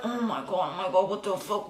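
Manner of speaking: hushing her panic so the principal won't hear; panicked hush. Retold or imitated. Teen panics quietly to her friend about the principal, hushed.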